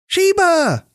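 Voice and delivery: high, loud holler across the yard; mother hollering for her cat from the yard. A narrator's reading. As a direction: call the cat sharply from afar. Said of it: "Denny's mummy hollered from her yard".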